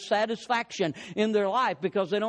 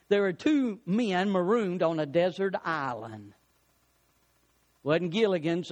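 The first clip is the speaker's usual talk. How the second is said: usual speaking voice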